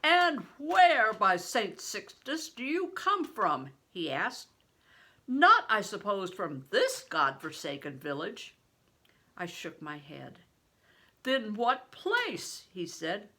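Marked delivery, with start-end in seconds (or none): 0.0-3.7 higher, louder, slow booming blessing; a giant of a man, booming and slow; big stranger. A narrator's reading. 5.3-8.5 big stranger. A narrator's reading. 11.2-12.7 higher, louder, slow booming blessing; a giant of a man, booming and slow; big stranger. A narrator's reading.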